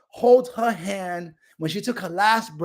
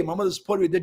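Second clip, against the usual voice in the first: normal talking voice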